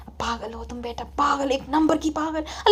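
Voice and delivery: hushed, soft scolding from a mother; mother gently scolding. Acted. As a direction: scold your daughter almost in a whisper. (अम्मी की दबी आवाज़ में डाँट)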